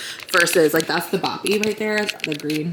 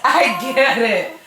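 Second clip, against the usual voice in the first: laughing; 5 semitones higher, 4 dB louder, much slower than usual. Real, not acted. Laughing at baby.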